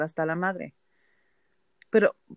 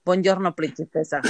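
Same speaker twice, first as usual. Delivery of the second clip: put-on Italian accent. Coworker Lorela greeting her each morning, light Italian accent. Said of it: "y con acento italiano".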